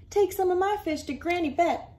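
lightly voiced fisherman; jolly fisherman. Sam continues.

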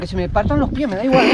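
ordinary talk, nothing marked

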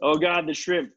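ordinary talk, nothing marked

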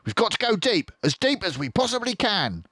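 high, firm order; commanding fox; urgent command. Acted. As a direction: push them to dig. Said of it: "ordered Mister Fox".

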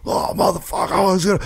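high mock angry outburst; angry guy voice. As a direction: play the furious drunk guy. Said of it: "and he was".

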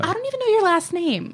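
high, incredulous mock reply; high, startled, half-laughing response; surprised. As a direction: squeak it out in disbelief. Woman answers sudden proposal.

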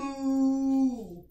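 long, sustained moo; cow moo. Shown as a demonstration. Teacher in cow position with tummy sucked in, long mooing. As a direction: hold the moo on one breath. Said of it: "get in our cow position".

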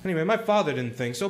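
normal talking voice

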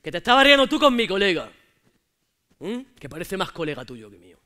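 reproche fuerte y celoso; échaselo en cara con rabia. Acted. (novio celoso reprochando en voz alta)

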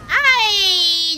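indignant yelp. Real, not acted. Hit by someone.

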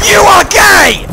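yelled high and loud; shout. Real, not acted. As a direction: bellow it at a friend. Teasing shout at teammate.

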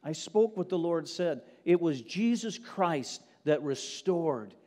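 loud, driving sermon delivery; full-force pulpit preaching. Acted. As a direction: preach it out to the whole room. Preaching to congregation.

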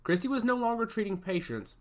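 ordinary delivery, nothing marked

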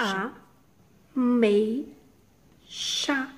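"A, mei, xa" with a pause after each syllable; teacher splitting "ameixa". Shown as a demonstration. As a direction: chop "ameixa" into three beats. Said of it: "E a palavra ameixa?".

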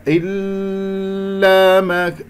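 "إلا" chanted high with the ل held very long; cling to the ل in "إلا" far too long; over-held ل. Shown as a demonstration. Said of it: "أطال زمن اللام".

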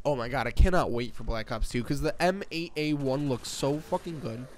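ordinary talk

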